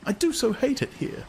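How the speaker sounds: high, unhappy woman's voice; a woman begs to go home; Lenina pleading. A narrator's reading. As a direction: plead against the place.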